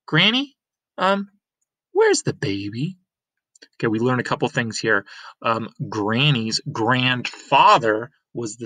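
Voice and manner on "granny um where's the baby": put-on much higher girl's voice; bright young girl calling out; girl Lena. Lena coming home to her Granny, higher girl's voice.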